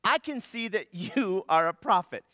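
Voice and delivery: lightly voiced woman, impressed. Woman quoted with a laugh.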